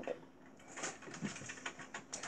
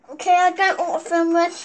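flustered; 5 semitones higher, 7 dB louder, faster than usual. Real, not acted. Flustered after sour candy.

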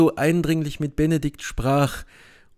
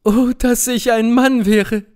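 much higher, louder, indignant cry; outraged cry; 9 semitones higher, 5 dB louder than usual. Acted. Beatrice to Benedikt, accusing Claudio: high, loud outraged cry. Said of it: "rief Beatrice aus".

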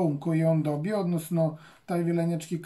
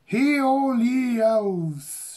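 loud, high, slow proclamation; crying out; 6 semitones higher, 6 dB louder, much slower than usual. Acted. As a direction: call out to all the elves. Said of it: "she cried to those about her".

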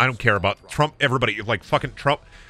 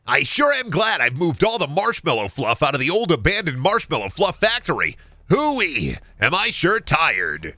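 higher, swinging cartoon voice; Scooby-Doo episode character; 3 semitones higher than usual. Worker at the marshmallow factory, cartoonishly weary. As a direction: ham up a cartoon villager after a job. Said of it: "can you ad-lib a Scooby-Doo episode".

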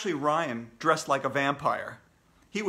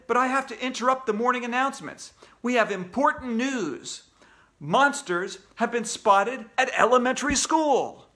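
raised, emphatic newsflash; urgent news bulletin; 8 semitones higher, 5 dB louder than usual. Acted. AJ breaking news of monsters, urgent announcer.

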